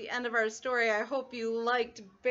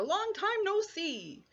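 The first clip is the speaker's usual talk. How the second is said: lightly voiced cheerful hare; a hint of a jolly hare; 8 semitones higher than usual. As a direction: greet him like an old friend. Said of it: "says Hare".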